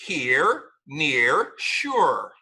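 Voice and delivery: here, near, sure with a clear R; correct R. Shown as a demonstration.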